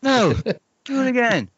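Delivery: very high, demanding coach voice; shrill order to redo the trick; Lutzka coach impression. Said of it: "Here's my impression of Lutzka as a coach".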